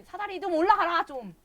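high, loud frustrated order at the game; frustrated shout. Real, not acted. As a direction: bark the order at the stubborn character. Frustrated at character not climbing. (답답해서 높고 크게 소리치는 명령)

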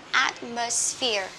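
slow "Atmosphere" with an audible hiss on the middle "s". Shown as a demonstration. Teacher repeats the word for learners, hissed middle S in "Atmosphere". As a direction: let the S in "Atmosphere" hiss before "phere". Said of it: "những âm S ở giữa này các bạn vui lòng xì ra".

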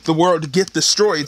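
ordinary talk

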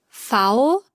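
ordinary delivery

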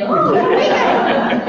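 high, slow, laughing dog voice; be the greedy dog spotting bacon; dog thinking aloud about bacon; excited dog voice. Acted.

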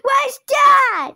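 high, loud pretend fright; mock panic. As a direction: shout like a ghost just showed up. Pretend ghost appears, kids hide.

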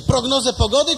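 high, loud indignation; indignant outburst. Acted. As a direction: protest in disbelief. Indignant patient. (oburzony pacjent, wysoko i głośno)

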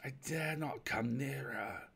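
hesitant, stretched-out words from the dark; haunted castaway, halting and hushed; fearful unseen stranger. Acted. Stranger answers from darkness.

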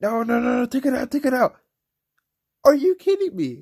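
frantic high, loud yelling; begging the troops to take it out; real gamer rage, pitch flying up; raging. Real, not acted. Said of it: "I am raging right now".

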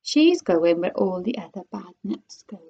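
hushed, half-voiced whisper; whispery storybook character voice. A narrator's reading. Wonka hints darkly at her fate.

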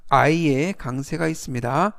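ordinary talk

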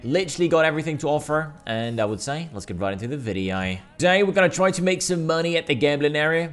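ordinary talk, nothing marked

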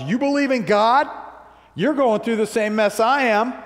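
high, disbelieving question; incredulous challenge. Retold or imitated. Onlookers confronting a believer in a storm, incredulous and high.

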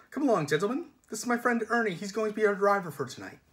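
high, chirpy gentlemanly villain; lilting, politely menacing host; breezy polite villain. Acted. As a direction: introduce a new friend with cheery command. Passenger character takes charge cheerily.

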